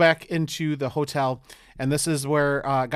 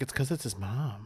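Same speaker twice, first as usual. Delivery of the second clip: muttered aside; 5 semitones lower, 11 dB quieter than usual. Retold or imitated. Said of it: "And I'm like".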